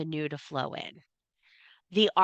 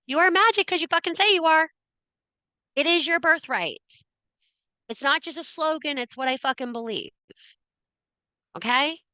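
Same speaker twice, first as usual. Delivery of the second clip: fired-up; 10 semitones higher than usual. Real, not acted. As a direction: pump them up. Fired-up affirmation to viewers.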